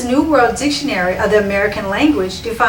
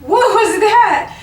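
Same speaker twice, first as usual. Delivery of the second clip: much higher, slow, incredulous; stunned; 10 semitones higher, 4 dB louder, slower than usual. Retold or imitated. Woman answering the proud leader, much higher stunned disbelief. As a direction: throw it up high, stunned and confused.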